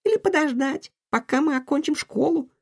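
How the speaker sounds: put-on high girl voice, a touch shaky; frightened girl, voice trembling a little. A narrator's reading. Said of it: "дрожащим голосом".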